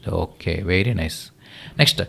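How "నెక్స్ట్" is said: normal talking voice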